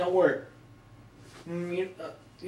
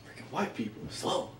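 normal talking voice

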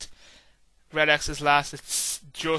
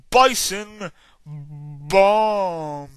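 slow, louder stretched Bison Bomb; drawn-out hype call; 7 dB louder, much slower than usual. Real, not acted.

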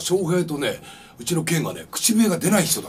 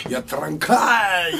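Osaka dialect quote; much slower than usual. Retold or imitated. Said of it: "でも大阪弁やもんね".

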